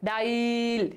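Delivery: slow "ডাইল" with the ই drawn out; fully pronounced. Shown as a demonstration. Teacher showing a whole vowel, "ডাইল" with long ই. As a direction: stretch the ই in "ডাইল" on one note. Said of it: "পূর্ণরূপে উচ্চারণ হচ্ছে".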